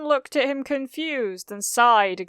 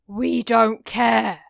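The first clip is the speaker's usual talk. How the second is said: slow angry growl; 2 semitones higher, 5 dB louder, slower than usual. Acted. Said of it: "Kacchan growled".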